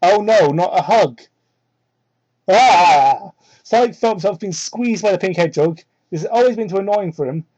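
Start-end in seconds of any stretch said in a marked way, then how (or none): 2.5-3.3 mock scream. Real, not acted. Reacting to the hug.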